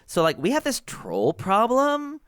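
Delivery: high breezy gnome, constant "like"; valley girl gnome mayor. Acted. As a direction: tell it casually, like gossip. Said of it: "Bilby Bourbon Durbin says".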